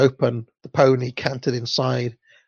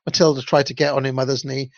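usual speaking voice

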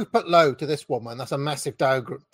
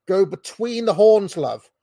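ordinary talk, nothing marked

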